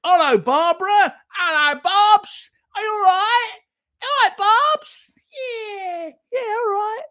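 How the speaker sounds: very high, loud comic voice; high, silly chummy character; hammed-up greeting. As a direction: squeal hello to a late arrival. Acts greeting people just tuning in.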